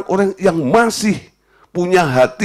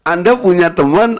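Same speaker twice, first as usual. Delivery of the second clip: ordinary talk, nothing marked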